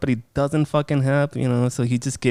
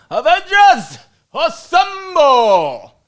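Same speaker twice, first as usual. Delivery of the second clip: high, loud rallying shout, long drawn out; battle cry; 13 semitones higher, 7 dB louder, much slower than usual. Pretend. Fan shouting the superhero rallying cry. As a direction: call the team together like a superhero leader.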